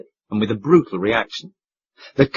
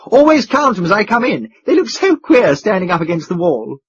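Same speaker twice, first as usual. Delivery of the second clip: higher, eager and chatty; excited boyish chatter; 6 semitones higher, 4 dB louder than usual. Acted. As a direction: rattle it off eagerly. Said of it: "cried the young duke".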